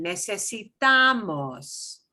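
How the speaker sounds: "Necesitamos" slowed, stress moved to "ta"; slow stress shift. Shown as a demonstration. Teacher showing student the shift to "ta" in "Necesitamos", slowly. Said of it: "second to last is this one".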